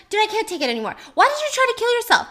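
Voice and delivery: imitating a fed-up cop's outburst; exasperated officer. Police officer at the bedside demanding answers, exasperated.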